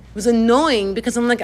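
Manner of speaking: ordinary talk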